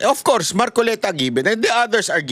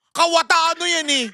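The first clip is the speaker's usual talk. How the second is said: high, sing-song name-calling; mocking taunt; 8 semitones higher than usual. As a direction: taunt like the old critics did. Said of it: "ano tawag niyo sa amin nun?".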